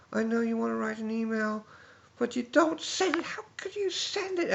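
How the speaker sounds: very high, rising into a shrill outraged squeal; mock angry Debbie. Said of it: "She was so angry".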